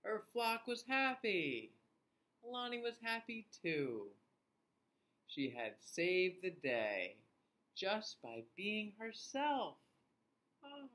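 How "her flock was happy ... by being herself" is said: story reading. A narrator's reading. Reads story to children.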